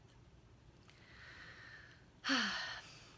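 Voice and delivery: long, slow settling exhale; long breathy sigh. Real, not acted. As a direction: let out a big, airy sigh before beginning. Settling sigh before starting meditation.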